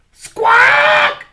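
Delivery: very high, loud squawk; crow squawk. A narrator's reading. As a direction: shriek it like a crow. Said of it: "said the crow".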